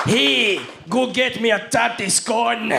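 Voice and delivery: high, hammed-up broad Scottish shout; Drew Galloway. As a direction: yell it like a Scottish wrestler. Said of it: "Do you want me to Drew Galloway it up?".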